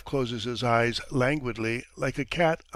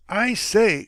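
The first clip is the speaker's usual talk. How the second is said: high, loud call through the wall; a touch of irritated outcry; crying out; 8 semitones higher, 6 dB louder than usual. Acted. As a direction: shout to get the lodger's attention. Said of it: "cries Krasny Hin".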